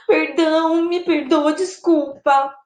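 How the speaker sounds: acted contrite plea; apologising. Shown as a demonstration. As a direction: beg forgiveness, drawing out the last word. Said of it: "De desculpas".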